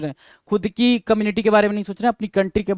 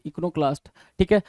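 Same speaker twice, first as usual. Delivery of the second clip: ordinary talk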